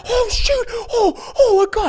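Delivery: high-pitched mock panic; panicked exclamations. Driver flees a pecking turkey into his car, panicked. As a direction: yelp each "oh" in alarm.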